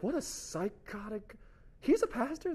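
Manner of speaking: high-pitched appalled onlooker; scandalized, rising mock shock; mock incredulity. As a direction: stretch it out in acted disbelief. Acts out a shocked onlooker judging him.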